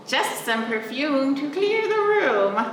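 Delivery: a hint of a sly skunk.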